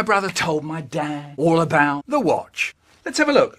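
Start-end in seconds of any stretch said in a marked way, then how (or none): 3.0-3.6 higher, demanding dad; dad's demand. Retold or imitated. Dad demanding to see the broken watch, higher. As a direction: insist on seeing it.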